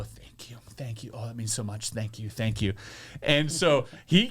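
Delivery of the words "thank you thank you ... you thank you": soft, gracious, over-polite thanks; mock gracious thank-you, soft and lower; fake humble thanks. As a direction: gush thanks quietly, ignoring the critique. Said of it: "I'm gonna go".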